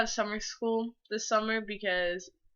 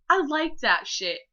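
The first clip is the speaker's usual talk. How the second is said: higher, louder, giggly delivery; giddy; 7 semitones higher, 4 dB louder than usual. Real, not acted. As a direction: say it with an embarrassed giggle. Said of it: "I ended it with".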